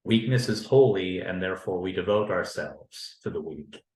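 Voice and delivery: ordinary talk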